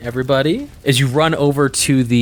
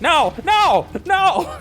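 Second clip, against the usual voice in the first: much higher panicked squeak; terrified kobold; much slower than usual. Acted. Kobold begging as the druid grabs him. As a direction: squeal in terror. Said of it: "he's looking at you going like".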